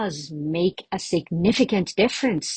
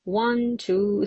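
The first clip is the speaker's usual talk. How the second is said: ordinary talk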